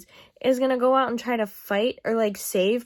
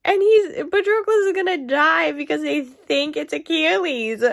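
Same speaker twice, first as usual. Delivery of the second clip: higher and louder, furious disbelief; infuriated rant; 6 semitones higher, 6 dB louder than usual. Real, not acted. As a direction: rant at the book, fuming and loud. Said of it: "I am infuriated right now".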